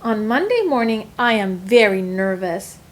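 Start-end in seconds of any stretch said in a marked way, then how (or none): none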